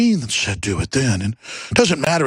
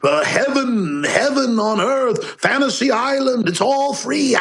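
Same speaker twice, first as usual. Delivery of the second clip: high, drawn-out dreamy crowing; blissed-out mock wonder, ending in laughter; mock rapture; 8 semitones higher, slower than usual. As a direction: gush mockingly, stretching the words higher and higher. Mock rapture over free things.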